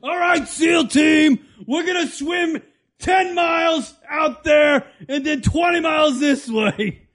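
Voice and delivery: slow, high barked orders; drill sergeant. Pretend.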